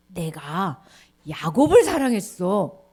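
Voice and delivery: low, firm reply; God's calm answer. Acted. God answering angry people, firm. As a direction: answer slowly and firmly. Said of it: "뭐라고 얘기하시냐면".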